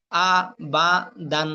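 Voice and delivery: আ, বা, দান one syllable at a time; broken syllables. Shown as a demonstration. Teacher showing learners broken pronunciation, syllable by syllable. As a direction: break আ, বা, দান apart, pausing after each. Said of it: "ভাঙ্গা উচ্চারণ করবেন একটা একটা করে".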